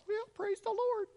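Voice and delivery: high, sing-song fake praise; mock pious. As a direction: sound falsely cheerful.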